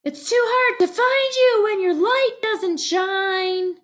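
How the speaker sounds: high voice calling after a friend; high-pitched Legs voice. Acted. Legs complaining to hiding Frenzy, high and lightly pleading. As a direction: keep Legs's voice up high. Legs's voice, same character.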